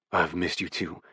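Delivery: a light low growl back. A narrator's reading. Gavin answering Oliver, mild growl. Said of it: "he growls".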